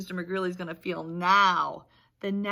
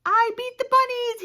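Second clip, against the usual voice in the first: much higher, louder triumphant cry; whooping; 8 dB louder than usual. Acted. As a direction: whoop it in victory. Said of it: "he whooped".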